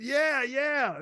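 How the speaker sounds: high, loud eager "yeah, yeah"; excited cheer. Retold or imitated. Kids cheering mother's new position, excited. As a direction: cheer it like thrilled kids, pitched well up.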